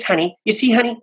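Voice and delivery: a hint of the whiny rich friend calling honey; whiny rich girl. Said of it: "this whiny, whiny voice".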